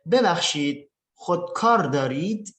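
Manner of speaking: "ببخشید، خودکار دارید؟" phrase by phrase with a questioning rise; question intonation. Shown as a demonstration. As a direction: demonstrate the question tune on "خودکار دارید؟", lifting "دارید". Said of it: "Perhatikan intonasinya juga ya".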